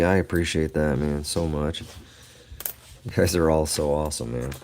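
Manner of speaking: normal talking voice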